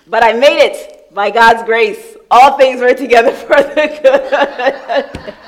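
loud, high, slow laughing proclamation; preaching. Real, not acted. Preacher tells the church of God's grace, loud and slow. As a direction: proclaim it slowly with joy. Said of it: "preaching full force".

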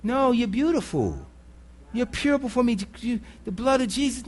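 soft, tender reassurance; God comforting. Retold or imitated. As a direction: gently reassure a shamed child. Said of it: "What do you think God says to you".

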